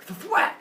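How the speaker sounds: high, loud smack sound effect; mock thwack. Teacher making the rock-hit sound for students. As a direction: pop out the hit noise sharply.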